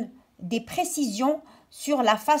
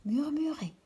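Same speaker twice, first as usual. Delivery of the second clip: murmured; 4 semitones higher, 7 dB quieter than usual. Shown as a demonstration. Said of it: "S'il parle à voix basse".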